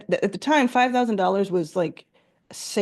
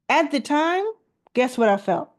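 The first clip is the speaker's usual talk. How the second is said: ordinary delivery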